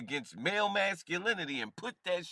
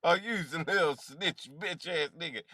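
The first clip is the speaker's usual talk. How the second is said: ordinary delivery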